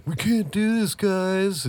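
high, whiny mock plea; hammed-up high pleading; imitating the character. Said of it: "The one that was all like".

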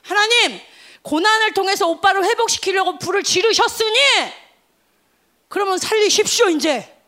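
high, loud commanding prayer; bold prayer. Retold or imitated. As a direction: declare it boldly to God. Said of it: "근데".

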